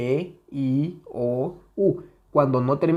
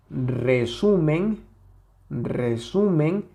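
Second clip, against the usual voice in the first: resumen slowly, with the middle syllable stretched; stress on SU; much slower than usual. Shown as a demonstration. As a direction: draw out SU in resumen. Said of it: "aquí se escucha la mayor intensidad".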